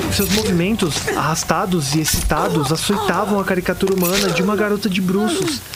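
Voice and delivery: high-pitched, stumbling, appalled narration; horrified agitation. Acted. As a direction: tell it in rising panic, tripping over sentence starts. Narrator relives a horrifying massacre.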